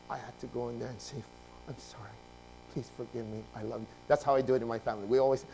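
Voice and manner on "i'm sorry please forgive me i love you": low, very soft plea; humble apology. Retold or imitated. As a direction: own up quietly and tenderly. Re-enacts apology to the elder.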